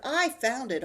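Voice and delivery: put-on loud indignant child; arguing. Arthur arguing with Buster over the chest, loud. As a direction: insist it's yours. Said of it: "argued Arthur".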